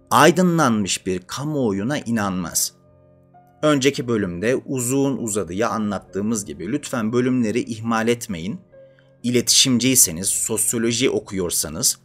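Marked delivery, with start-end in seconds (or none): none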